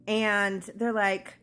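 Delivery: ordinary talk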